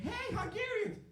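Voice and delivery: very high-pitched call; hammed-up high greeting shout; mock excited greeting. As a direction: squeal a hello to fellow countrymen. Mimics greeting among Hungarians abroad.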